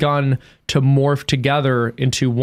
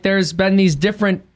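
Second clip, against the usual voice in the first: ordinary talk, nothing marked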